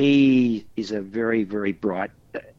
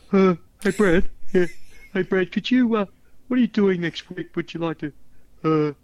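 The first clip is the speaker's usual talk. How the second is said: higher-pitched, laid-back imitation of Ralph; Ralph impression; 6 semitones higher than usual. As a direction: play the casual visitor, pitched up high. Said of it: "May I, may I mimic him?".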